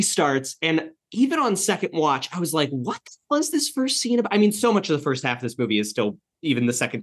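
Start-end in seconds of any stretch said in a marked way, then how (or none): 2.7-4.3 high-pitched, baffled exclamation; mock bewilderment. Viewer reacting to a confusing opening scene, comically bewildered.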